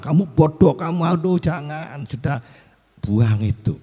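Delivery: high-pitched parental put-down; imitating a scolding parent; mock scolding.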